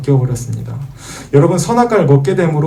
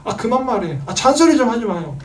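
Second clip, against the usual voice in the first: put-on teen talking back to parents; annoyed teenager; 10 semitones higher than usual. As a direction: whine it like a teen fed up with nagging. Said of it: "그래서 부모님 말씀하면".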